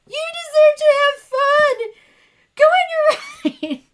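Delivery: squealed, loud, hammed-up crying voice; mock sobbing. Wife urging husband to keep riding, imitating her own sobbing. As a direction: wail it high and loud, breaking into a laugh. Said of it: "I was just started sobbing".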